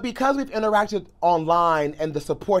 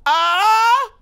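high, loud drawn-out "ah"; loud sustained squeal of recognition; imitated joyful squeal; 7 dB louder than usual. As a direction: imitate an open-armed greeting cry. Imitating her greeting on seeing him.

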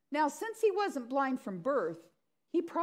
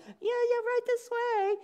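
very high, falsely obliging; mock embarrassed, overeager usher; squeaky, louder show of helpfulness; humiliated; 13 semitones higher, 4 dB louder than usual. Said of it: "You would feel really humiliated".

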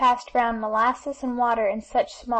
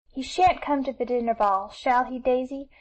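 usual speaking voice

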